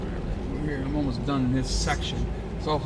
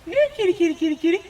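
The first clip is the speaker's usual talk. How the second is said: high coaxing call, a bit louder; very high, louder kitty call; 5 dB louder, much faster than usual. Real, not acted. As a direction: call the cat out loud and high. Calling a cat from car.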